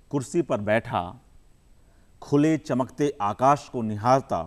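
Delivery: measured recital with long pauses between phrases; poem recitation. Acted. Poet reciting his poem to the audience, measured and unhurried. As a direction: savour each image, let the lines breathe.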